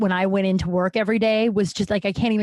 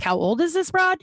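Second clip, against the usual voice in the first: mock incredulous listener, pitched up; incredulous question; 8 semitones higher than usual. Audience member asking in mock disbelief about the woman's age. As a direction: play the shocked audience member.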